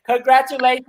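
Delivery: high-pitched cheery call; imitating a friend's high congratulations; excited congratulating. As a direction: congratulate from across the stage. Imitating Edna coming with congratulations.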